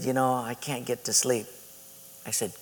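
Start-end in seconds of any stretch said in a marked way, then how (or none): 0.0-1.5 low, soft, sheepish voice; downcast confession. Retold or imitated. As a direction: imitate a friend hanging his head. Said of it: "his head was hung like this".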